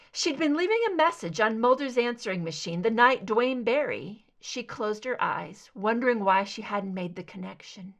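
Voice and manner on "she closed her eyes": plain reading voice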